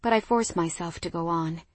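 ordinary delivery, nothing marked

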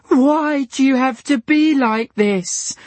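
very high, loud and slow; tearful wail. A narrator's reading. Diana crying at Dylan. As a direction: cry the question. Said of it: "wailed Diana".

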